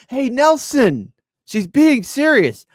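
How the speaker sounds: high, slow, loud cartoon bully voice; terrible Nelson impression. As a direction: ham up a bratty cartoon bully. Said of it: "that does a terrible Nelson".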